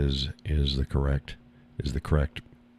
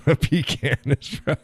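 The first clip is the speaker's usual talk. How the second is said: giggling through the words; laughing; 8 semitones higher, slower than usual. Real, not acted. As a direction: laugh as you repeat the joke.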